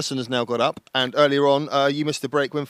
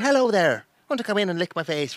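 higher, lilting friendly Southern Irish accent; a cheery Southern Irish host inviting you in; bright lilting Southern Irish welcome; light Southern Irish; 7 semitones higher, 3 dB louder than usual. Shown as a demonstration. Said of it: "Southern Irish is all kind of light and friendly".

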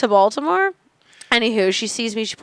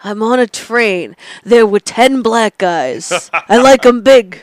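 slow, deliberate character voice, building louder; Skippy Green; 7 dB louder, slower than usual. Acted. Comic answering as her character on request, slow character voice. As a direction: drag out each phrase, punching the last one. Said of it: "what would Skippy Green think".

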